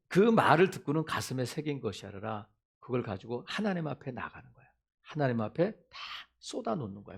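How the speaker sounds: ordinary delivery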